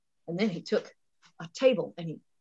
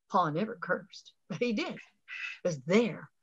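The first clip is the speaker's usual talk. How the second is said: ordinary delivery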